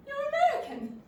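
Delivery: surprised exclamation. Acted.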